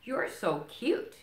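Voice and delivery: cooing Rabbit. Acted. Rabbit voiced in read-aloud.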